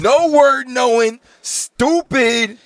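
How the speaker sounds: high, slow mocking voice; mock insult. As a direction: sneer it out in a silly high put-on voice. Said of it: "I'm like".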